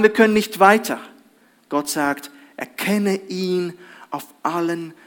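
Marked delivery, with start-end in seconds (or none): 0.0-1.0 fast loud complaint. Pretend. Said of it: "Wir sagen".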